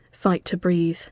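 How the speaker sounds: ordinary delivery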